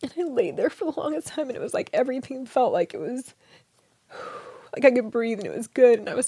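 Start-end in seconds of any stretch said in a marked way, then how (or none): none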